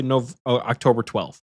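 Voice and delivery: ordinary talk, nothing marked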